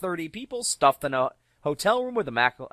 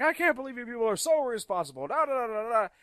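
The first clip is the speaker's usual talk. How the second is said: fast, higher mock scolding; scolding rant; 10 semitones higher than usual. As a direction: rattle off the lecture like a fussy prude. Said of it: "he's like".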